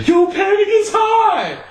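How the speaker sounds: hammed-up teenage yell; son shouting up. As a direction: holler it from the floor below. Son calling out from downstairs.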